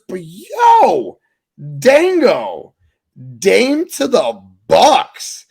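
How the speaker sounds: loud, high, drawn-out exclamation; hyped reveal. Real, not acted. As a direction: call out the big news to your co-host in disbelief. Host reveals surprise trade, calling out.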